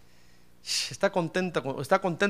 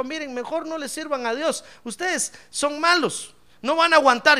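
raised, insistent acted warning; Joshua warning the people; 8 semitones higher than usual. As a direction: warn them off in a high, urgent pitch. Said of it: "Josué les dijo". (advertencia aguda e insistente)

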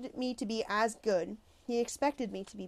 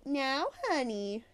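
real sweet; 4 semitones higher, much slower than usual. A narrator's reading. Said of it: "real sweet".

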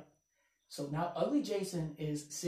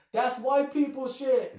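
slow, higher, louder heckle through laughter; retold heckle; 7 semitones higher, 6 dB louder, slower than usual. A heckler dismissing the comic's joke, laughing mock heckle.

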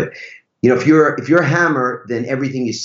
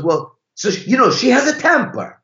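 high, hesitant mock Italian husband; awful Italian accent; 13 semitones higher than usual. Driver admits something about his wife, awful Italian accent. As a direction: break it gently in a high-pitched Italian accent. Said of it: "That's an awful Italian accent".

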